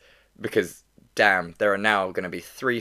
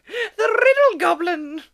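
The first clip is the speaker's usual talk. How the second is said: very high, slow, louder goblin squeak; 7 dB louder, slower than usual. Pretend. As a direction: announce it like a tiny goblin. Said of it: "I love my goblin voice".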